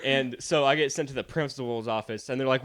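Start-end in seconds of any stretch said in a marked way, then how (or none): none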